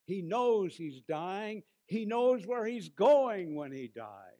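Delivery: slow ringing declaration of faith; emphatic exclamation. Retold or imitated. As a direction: proclaim it to the nurse with awe. Preacher recalls exclaiming to nurse.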